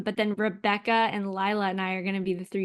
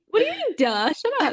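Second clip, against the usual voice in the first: mock indignant retort; 10 semitones higher than usual. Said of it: "And then it's like".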